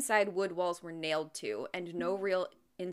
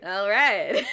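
louder Texan drawl on all right; a hammed-up Southern all right; McConaughey catchphrase; 10 dB louder than usual. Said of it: "Matthew McConaughey".

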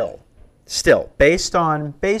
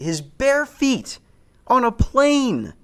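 pitched way up, slow and appalled; high disgusted outburst; 12 semitones higher, slower than usual. Retold or imitated. Comic telling the crowd about a seatmate's bare feet, appalled and high.

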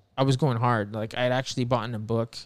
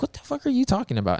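incredulous; 11 semitones higher, 3 dB louder, faster than usual. Retold or imitated. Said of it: "And he's like".

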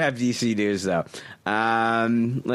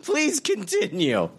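slow, higher mock pleading; sarcastic plea; 8 semitones higher, slower than usual. Host sarcastically begging the cohost to continue, slow and high. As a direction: beg him with heavy sarcasm.

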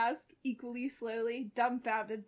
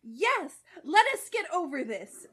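cheerful Starfire; 6 semitones higher, 8 dB louder than usual. Acted. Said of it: "putting a cheerful twist on the repetition".

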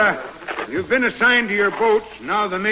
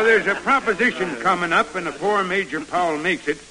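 ordinary talk

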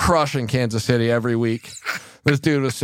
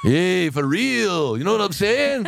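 put-on voice; 8 semitones higher, slower than usual. High slow put-on voice.

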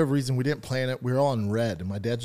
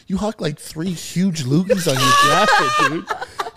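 laughing; 7 semitones higher, 7 dB louder, slower than usual. Real, not acted. Laughing at buddy's loogies.

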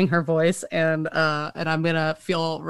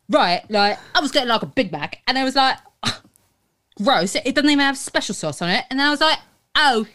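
put-on chatty British chav accent, higher pitched; 4 semitones higher than usual. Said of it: "in that accent".

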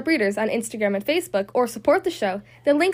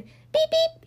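high happy beep; high grinning truck beep; grinning horn beep; 13 semitones higher than usual. Acted. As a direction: beep it with a grin. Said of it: "he said, with a little blue grin".

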